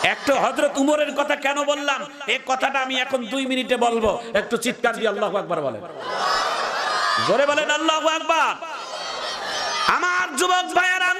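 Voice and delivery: high, chanted preacher's call; preaching at full force. Real, not acted. Preacher promises the crowd a story about Umar, full-force chanting. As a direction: rouse the crowd in a high intoned voice.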